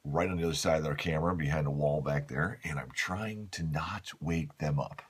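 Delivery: hushed voice so as not to wake a guest; talking quietly. Real, not acted. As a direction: keep it low and hushed. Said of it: "I am talking a little bit quieter this morning".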